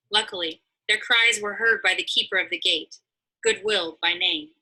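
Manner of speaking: ordinary delivery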